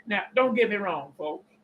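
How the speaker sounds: ordinary delivery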